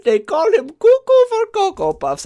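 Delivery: high, loud, cartoonish villain imitation; a mock trailer narrator in a cartoonish accent; Doofenshmirtz voice. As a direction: ham it up like a cartoon mad scientist. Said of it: "I don't know why I sound like Doofenshmirtz".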